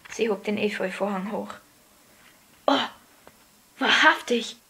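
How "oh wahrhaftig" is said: breath-caught, mildly amazed; gasped words. A narrator's reading. Colin sees the hidden door, gasping lightly. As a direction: let it out in a small gasp, word by word. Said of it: "keuchte Colin".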